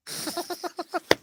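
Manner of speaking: short high giggle; a high squeak of a laugh. Real, not acted. Comedian giggling high at his own dark joke.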